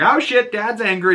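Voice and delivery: high-pitched mock panic; dog's alarmed voice. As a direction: voice a dog caught out by its owner. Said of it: "he's like".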